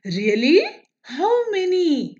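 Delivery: rising up high in amazement on how many; a sudden amazed question; surprised. Acted. Moon asking the sun, amazed, how many stars. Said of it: "Chandamama was surprised".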